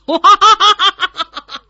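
high, loud booming ha-ha of a bold warrior; hearty laugh. Acted. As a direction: laugh big and proud, falling away. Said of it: "丁德兴哈哈一笑".

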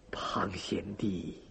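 very low, faint, slow call; barely manage to call out to your sworn brother; weak injured man. A narrator's reading. Injured man calls on waking.

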